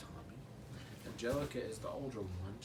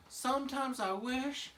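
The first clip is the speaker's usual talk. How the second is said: put-on higher wishing toddler; higher baby Tommy voice; 8 semitones higher than usual. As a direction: pipe it up like a cartoon baby. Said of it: "he's like".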